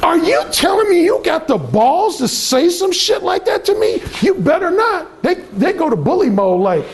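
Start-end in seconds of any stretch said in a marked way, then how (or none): 0.0-5.2 high, mocking dare; mock her daring you; high mock shouted challenge; testing challenge. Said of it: "saying basically to you".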